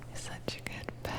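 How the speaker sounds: barely-there whisper while petting; soft whispered praise while giving head pats; close soft whisper. Acted. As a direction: breathe the praise right into the ear.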